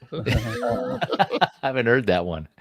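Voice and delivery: laughing while talking; words through real laughter. Real, not acted. Laughing at the rhyme.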